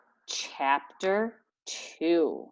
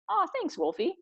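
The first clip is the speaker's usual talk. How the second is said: touched; 13 semitones higher than usual. Acted. Legs thanking Wolf, high and touched. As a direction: sound sweetly touched.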